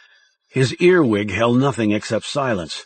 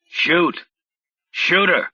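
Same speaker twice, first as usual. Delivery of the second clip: urgent handler; 6 semitones higher, slower than usual. A narrator's reading. Handler urging over earpiece.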